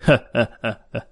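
short gloating chuckle; smug laugh. A narrator's reading. Gang boss laughs, smug and gloating. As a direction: laugh like a pleased bully. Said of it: "Hùng Cọp cười đắc chí".

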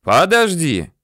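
loud, high plea to hold on; yelling. A narrator's reading. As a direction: shout to make someone wait. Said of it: "заорал он".

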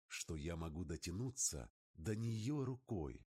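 usual speaking voice

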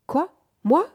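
short, mildly startled questions; startled cry. A narrator's reading. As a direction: react as if jolted from a daydream. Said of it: "cria March".

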